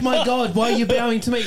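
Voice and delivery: high, loud retold disbelief; overwhelmed, incredulous outburst; astonished fan. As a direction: cry it out in flustered amazement. Retold astonishment at being bowed to.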